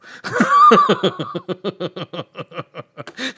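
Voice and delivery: long, high rattling laugh; drawn-out chuckling fit; laughter. Real, not acted. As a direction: laugh in a long run that tails off. Laughs at teasing retort.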